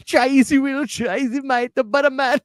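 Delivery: high laughing Jamaican accent impression. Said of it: "best Jamaican accent I've ever heard".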